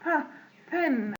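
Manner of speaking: aspirated P on "ب" and "pen"; P with air. Shown as a demonstration. Teacher demonstrating P for learners, puffed "pen". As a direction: let a puff of air out starting "pen". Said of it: "في هوا طالع".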